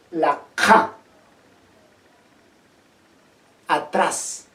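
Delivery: ja said far back as a uvular stop. Shown as a demonstration. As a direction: push ja to the back of the throat. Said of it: "no tienen problemas en pronunciar".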